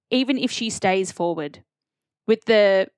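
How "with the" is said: loud breathing into the mic; breathing heavily into the microphone; heavy nose breathing. Real, not acted. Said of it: "stop breathing into the microphone".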